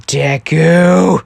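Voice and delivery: loud, drawn-out name call; angry yell. Acted. Pranked Bakugo yells at the prankster, angrily. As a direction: holler the name in mock fury.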